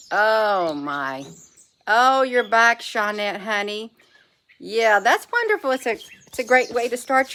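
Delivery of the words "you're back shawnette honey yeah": ordinary delivery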